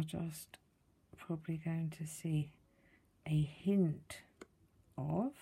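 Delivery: whispered under her breath. Real, not acted. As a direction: hush it while concentrating. Peering closely at work.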